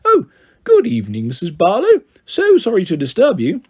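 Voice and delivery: put-on snobby, over-the-top posh English accent; ultra-posh, la-di-da English; silliest posh voice. A narrator's reading. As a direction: put on a silly, swooping posh phone voice. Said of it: "in the silliest, snobbiest, poshest voice ever".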